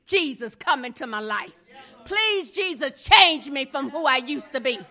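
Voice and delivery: starts hushed and pleading, builds to loud cries of please; a prayer that swells from a whisper to a cry; sample prayer. Pretend. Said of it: "you have not said".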